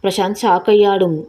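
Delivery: usual speaking voice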